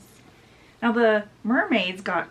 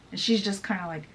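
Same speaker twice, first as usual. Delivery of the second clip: plain reading voice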